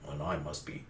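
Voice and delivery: very low, quiet brag; Zoro, smug boast. Retold or imitated. Zoro boasts with arms crossed, low smug voice. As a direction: drop deep into put-on self-importance.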